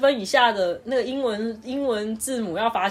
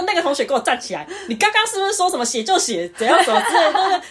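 raised, higher stern teacher voice; 8 semitones higher, 6 dB louder than usual. Retold or imitated. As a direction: call the student out in front of class. Teacher calls student out. (老师严厉地点名)

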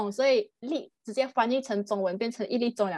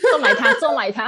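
laughing while repeating; 3 semitones higher, 8 dB louder than usual. Real, not acted.

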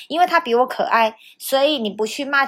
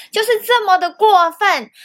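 higher, louder indignant cry; indignant outburst; 9 semitones higher, 6 dB louder than usual. Real, not acted. Telling viewers how unfair the teacher was, indignant. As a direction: exclaim in outrage.

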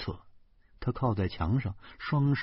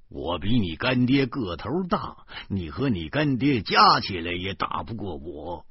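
ordinary delivery, nothing marked

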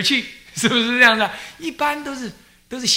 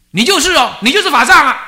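imitating a brother shouting down the phone, higher and louder; hammed-up hostile demand, half laughing; mock angry caller; 12 semitones higher, 14 dB louder than usual. Brother calling, demanding abbot.

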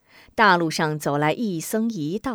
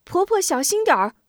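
put-on higher, quick child's voice; kindly boy calling a warning; caring boy; 11 semitones higher, faster than usual. A narrator's reading. Boy telling the old beggar woman to take care. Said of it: "并嘱咐一声".

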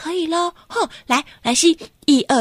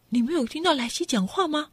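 usual speaking voice

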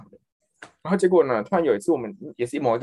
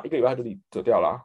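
ordinary delivery

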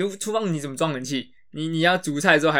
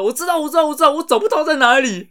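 high, louder mock dad protesting he knows; flustered insisting dad; 12 semitones higher, 6 dB louder than usual. Imitating dad to a friend, high and flustered insisting. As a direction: squeak out the insistence like a cornered dad.

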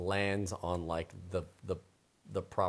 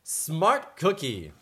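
ordinary talk

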